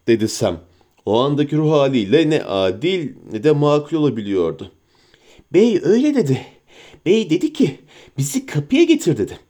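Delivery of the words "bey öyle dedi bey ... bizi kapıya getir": higher wheedling creature voice; sly creature whining at its master; Gollum voice. Acted. Gollum answers Sam.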